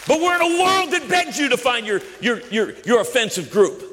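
high, forceful rallying declaration; impassioned preaching. Real, not acted. Preacher vows crazy stops with this family, high impassioned preaching. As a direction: preach it as a defiant vow.

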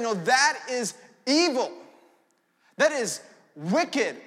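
slow, weighty verdict on evil; firm condemnation. Retold or imitated. Preacher to congregation, naming evil slowly and firmly. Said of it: "we're saying".

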